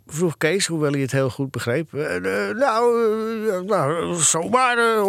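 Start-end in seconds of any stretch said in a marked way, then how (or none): 1.9-5.1 hesitant, higher-pitched stammering dodge; halting, evasive hemming and hawing. A narrator's reading. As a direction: stall and fumble for an excuse. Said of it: "ontweek Eddy".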